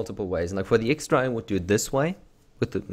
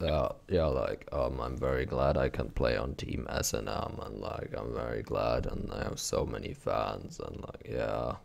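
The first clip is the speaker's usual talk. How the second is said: very low, quiet, drained monotone; Dyrus; 10 semitones lower, 6 dB quieter than usual. Acted. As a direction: mumble it low, bored and worn out. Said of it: "this is my Dyrus impression".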